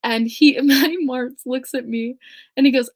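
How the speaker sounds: ordinary delivery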